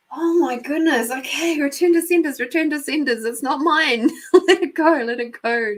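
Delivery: high, loud flustered chanting; hurriedly shooing it away, half laughing; mock frantic self-talk. Storyteller telling herself it's not hers, mock frantic. Acts out urgently releasing others' thoughts.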